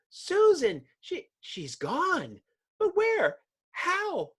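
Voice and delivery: high, squeaky bewildered cry; shocked friend. Acted. Friend finding Susan vanished, high and baffled. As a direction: yelp in confusion.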